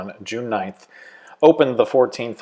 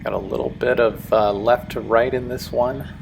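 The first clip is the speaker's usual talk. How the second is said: ordinary talk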